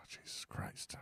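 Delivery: breathy exasperated whisper; weary whispered Jesus Christ. Real, not acted. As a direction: mutter it under your breath in disbelief. Exasperated aside.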